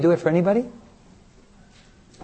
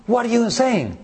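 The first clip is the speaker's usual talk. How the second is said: high-pitched, mildly acted outrage; indignant; 7 semitones higher, slower than usual. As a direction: protest in disbelief, voice pitched up. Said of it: "You would be furious".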